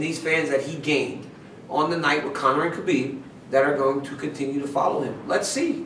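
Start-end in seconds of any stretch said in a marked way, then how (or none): 5.3-5.8 high rising let's see. Real, not acted.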